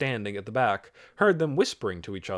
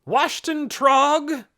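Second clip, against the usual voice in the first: high, loud, drawn-out call; 8 dB louder, much slower than usual. A narrator's reading. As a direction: call a name across a crowd. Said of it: "and called out".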